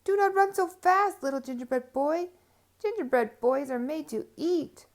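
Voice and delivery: high, loud call across the field. Acted. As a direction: call after him. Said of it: "and called".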